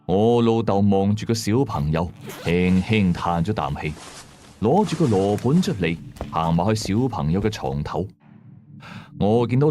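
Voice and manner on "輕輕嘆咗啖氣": usual speaking voice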